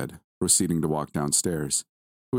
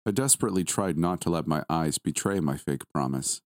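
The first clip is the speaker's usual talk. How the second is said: usual speaking voice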